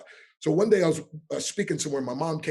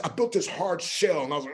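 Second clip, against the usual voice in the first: ordinary talk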